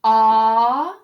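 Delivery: long loud "Ah"; single sound. Shown as a demonstration. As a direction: hold "Ah" as a full open vowel. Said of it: "syllable break".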